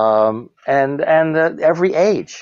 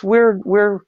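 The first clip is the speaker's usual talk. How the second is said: ordinary talk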